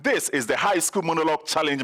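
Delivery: normal talking voice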